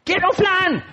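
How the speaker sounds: high, whiny mock demand building up; escalating hammed-up petulance; imitated demanding tantrum. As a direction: play a spoiled customer insisting. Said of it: "así hay gente, así ora".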